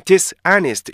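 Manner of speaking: "dishonest" as dis plus honest; mistaken split reading. Shown as a demonstration. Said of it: "이런 식으로 이렇게 하시면 절대 안". ("dishonest"를 디스, 아니스트로 나눠서)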